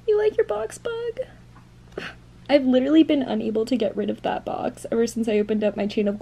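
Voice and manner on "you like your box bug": much higher cooing pet voice; high cooing question to the cat. Real, not acted. Baby talk to cat.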